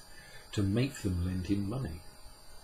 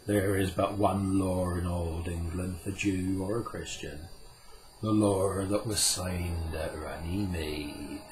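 Puck, slow and solemn. Acted. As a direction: lay down the old law slowly and gravely. Puck's voice in the story.

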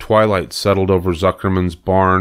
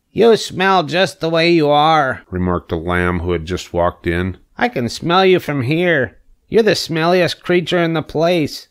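higher, mocking lamb voice; a snooty lamb teasing the pig; taunting lamb; 9 semitones higher than usual. Lamb mocking the pig's smell, higher snooty voice.

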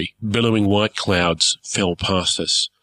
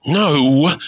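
higher and louder, curt no; cross interruption; 8 semitones higher, 5 dB louder than usual. Acted. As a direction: cut him off irritably. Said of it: "I interrupted crossly".

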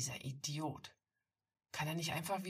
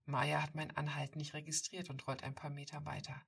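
ordinary delivery, nothing marked

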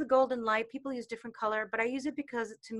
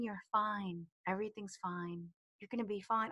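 calm inner guidance; 2 semitones lower, 8 dB quieter than usual. Retold or imitated. Inner guidance reassuring her during the crash, soft and calm. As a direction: say it gently like a voice calming her.

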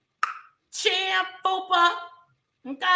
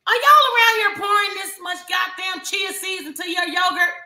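mock outrage; 6 semitones higher, 5 dB louder than usual. Real, not acted. Shouting rhetorical roast.

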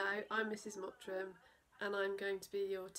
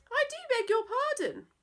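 very high, prim exclamation; father's prim protest; posh indignant father; 10 dB louder than usual. Acted. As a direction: sound affronted at the door. Father answers the farmer.

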